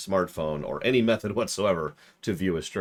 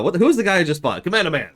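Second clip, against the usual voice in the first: higher and louder, fast excited outburst; 10 semitones higher, 8 dB louder, faster than usual. Real, not acted. Streamer reacts, excited, to the boss he just bought. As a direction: blurt it out, thrilled and surprised. Surprised at his new purchase.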